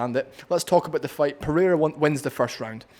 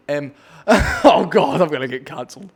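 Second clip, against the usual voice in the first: bursts out laughing, much louder; 10 dB louder than usual. Real, not acted. Vlogger laughing at his own insult, loud laughter. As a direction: laugh through it at your own rude joke.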